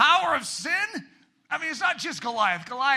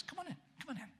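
much softer, coaxing call; gentle invitation; 6 semitones higher, 17 dB quieter, much faster than usual. Retold or imitated. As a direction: beckon someone in softly. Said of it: "Jesus actually says".

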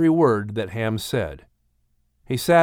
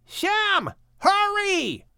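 calling out; 3 dB quieter, much slower than usual. Acted. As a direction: call from the street outside. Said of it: "Ham calling him outside".

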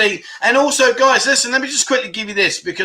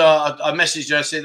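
normal talking voice

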